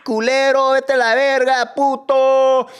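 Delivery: high, loud sneering curses; Spanish insults. Real, not acted. Ex-guard cursing out former colleagues in Spanish, loud. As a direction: spit the insults at them.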